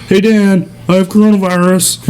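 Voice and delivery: high, sing-out phone announcement; imitating a friend on the phone. Friend's phone call voiced.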